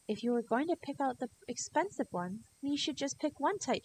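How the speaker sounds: lightly voiced scolding mom; a hint of a stern mother. A narrator's reading. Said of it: "she said".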